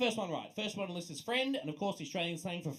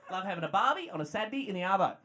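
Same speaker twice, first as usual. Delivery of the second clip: ordinary talk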